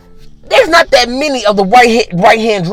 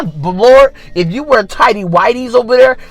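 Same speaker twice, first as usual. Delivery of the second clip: normal talking voice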